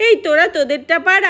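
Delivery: ordinary talk, nothing marked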